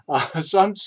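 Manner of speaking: ordinary talk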